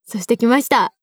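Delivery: ordinary delivery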